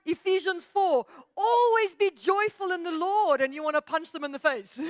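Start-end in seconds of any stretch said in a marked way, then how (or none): 0.1-3.4 higher, louder, sugary chirp quoting scripture; mock a relentlessly chipper believer, pitched up and loud; over-cheerful Buddy the Elf. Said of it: "look like Buddy from the movie Elf".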